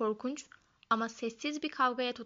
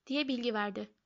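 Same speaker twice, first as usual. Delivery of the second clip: ordinary delivery, nothing marked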